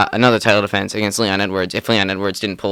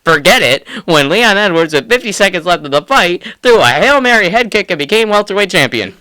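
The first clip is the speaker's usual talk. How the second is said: higher, louder, rising excitement at the knockout; excited retelling; 7 semitones higher, 5 dB louder than usual. Retold or imitated. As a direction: relive the moment you went bonkers. Reliving going bonkers at upset.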